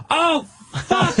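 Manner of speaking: high, laughing oh fuck; sudden horror, half laughing; panicked realisation. Retold or imitated. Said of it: "And I had a panic".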